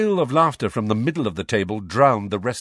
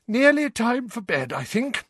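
high-pitched yawny voice; stifle a yawn as you speak; through a yawn. A narrator's reading. Mother hinting that it is bedtime, with a yawn. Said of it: "said Missus Weasley with a yawn".

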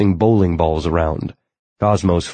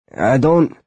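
awkward; 7 semitones higher than usual. A narrator's reading. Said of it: "Tak began awkwardly".

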